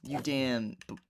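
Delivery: frustrated, cursing them out; angry ranting. Real, not acted. Vlogger curses the people who interrupted him, angry rant.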